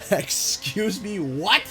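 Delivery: slow, loud, rising "Excuse me?"; mock indignant excuse me. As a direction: stretch "Excuse me?" out in loud disbelief. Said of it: "and I'm like".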